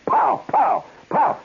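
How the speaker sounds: high, sharp run of POWs; imitated whip cracks. Storyteller as the tamer moving like a shot, whip cracks. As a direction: crack them out one after another. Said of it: "Then he goes".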